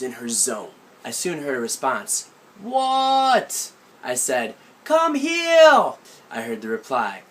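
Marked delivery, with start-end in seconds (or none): 2.6-3.7 distant call back. Retold or imitated. Aunt calls back from garden. 4.8-6.0 put-on high, loud childish "Come heel!" with r turned to l; whiny six-year-old calling out; a child in a tree demanding his aunt come; little kid shouting. Child calls aunt over.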